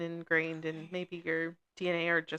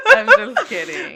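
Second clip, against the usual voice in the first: high, loud words through laughter; real laughter carrying the words; laughing it off; 14 semitones higher, 12 dB louder than usual. Real, not acted. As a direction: giggle out the take-back. Laughing off the joke.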